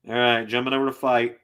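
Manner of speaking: ordinary talk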